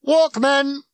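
sudden high, loud yell; one-word blaring shout. A narrator's reading. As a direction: holler it out to a whole crowd. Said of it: "he shouted".

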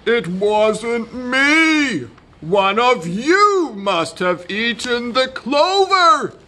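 high, protesting donkey voice; defensive donkey. A narrator's reading. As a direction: protest your innocence, stretching the words. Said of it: "defended the donkey".

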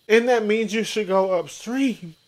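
sarcastic high-voiced questions; mock confused rant. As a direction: play the dumb bewildered one. Mock voicing Mike's confusion.

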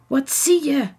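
hoge, luidere gretige vraag; dringend nieuwsgierig. A narrator's reading. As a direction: vraag het ongeduldig, met meer stem. Zelfde vraag, dringender herhaald. (dringend en nieuwsgierig)

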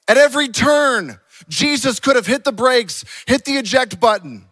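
loud, high, punchy sermon delivery; full-force preaching. Real, not acted. Preacher on Jesus refusing to escape, forceful preaching. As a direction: hammer the point home to the congregation.

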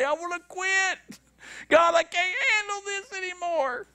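high, whimpering plea; mock crying, high and pitiful; whiny quitting. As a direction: whine it like someone ready to give up. Voicing the tired flesh.

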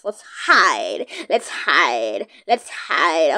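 low, goofy put-on deep voice; silly deep voice. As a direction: drop into a cheesy low voice. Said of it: "that's kinda cringe, I should stop doing that".

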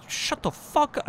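high, slow squeak; a silly high-pitched put-down; squeaky mock voice. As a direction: squeak it out slowly like a cartoon. Squeaky slowed line.

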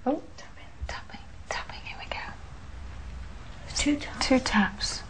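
tense whisper, barely audible. Real, not acted. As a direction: whisper while listening for taps. Hearing taps at seance.

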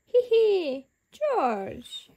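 high, spoken tee-hee; giggling child. A narrator's reading. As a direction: titter like a little kid, acted. Giggling at George's muddy hat.

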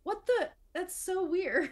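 high-pitched, incredulous viewer reaction; baffled reaction. Acted. Viewer reacting to a sudden fast zoom, baffled. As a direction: act out a weirded-out double take. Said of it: "you're just like".

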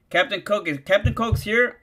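ordinary talk, nothing marked

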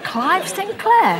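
slow, high, appalled emphasis on Clive Sinclair; mock outrage at being linked to him; indignant disbelief. Real, not acted. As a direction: drag out the name like it's an insult. Indignant at the rumour.

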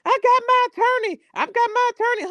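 high, mocking voice of someone bragging about their attorney; mocking bragging arrestee. S4 mocks women yelling at police, high and mocking. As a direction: squeal it like a smug arrestee filming the cops. Mocking people filming cops.

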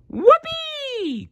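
very high whooping cry; joyful cry. Acted. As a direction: whoop with glee. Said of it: "cried Zebra".

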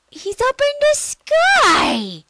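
high, slow, drawn out call; wondering cry. Real, not acted. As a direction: marvel at the sky. Kid calling out in wonder.

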